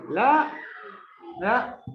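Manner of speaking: "la" punched out as the strong syllable; isolated, emphatic "la"; stressed syllable. Shown as a demonstration. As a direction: hit each "la" hard, on its own. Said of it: "¿dónde está la fuerza de voz?".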